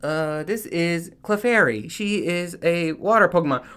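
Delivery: a hint of a Pokédex announcer. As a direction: read it like a scanner. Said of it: "he'll scan it and be like".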